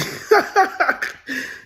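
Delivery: high, rapid-fire cackle; real high-pitched laughing fit; cackling laugh. Real, not acted. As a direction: burst into a wheezy staccato cackle. Laughs mid-story.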